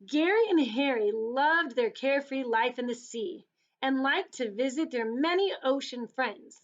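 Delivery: put-on lively, lilting storybook read to kids; reading to children. Teacher reading a picture book to children, lilting and lively. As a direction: paint the sea for little listeners, savouring each image.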